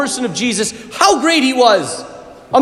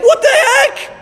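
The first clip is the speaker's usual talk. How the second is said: high, raised cry of disbelief; shocked; 11 semitones higher, slower than usual. Retold or imitated. Disciples hearing the news, high shocked cry. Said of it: "the disciples are like".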